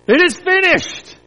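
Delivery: high ringing cry of triumph; loud shout. Acted. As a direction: shout it out as the final word. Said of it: "Jesus shouted out in a loud voice".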